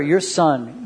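usual speaking voice

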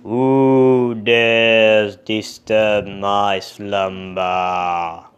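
slow, booming monster; loud, drawn-out monster roar. Acted. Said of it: "And the monster said".

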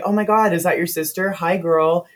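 high, excited gush; perky director fawning over a newcomer; gushing greeting. Retold or imitated. Director gushing over supposed sister.

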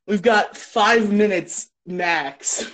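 usual speaking voice

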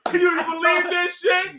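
high, loud, slow disbelieving yell; incredulous shout. Real, not acted. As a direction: stretch it out in disbelief.

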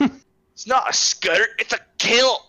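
high, slow indignant correction; hammed-up offended Brennan voice; offended Scotsman. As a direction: play the huffy friend defending his kilt.

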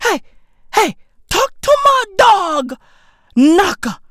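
high-pitched comic wail; squealed, insistent plea; exasperated demand. Acted. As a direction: cry it out in mock exasperation. Comic rant, repeated line escalates.

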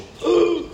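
high-pitched mock-shocked gasp. Congregation seeing him walk into shul late, mock gasp. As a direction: feign a scandalized little gasp.

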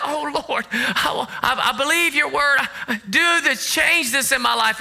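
high, mock pleading prayer; pleading believer. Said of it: "there'll come a time where you're like".

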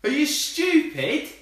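slow, high and loud; exasperated rant. Real, not acted. Scolding the dumb sidekick, exasperated yell. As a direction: drag out the disbelief.

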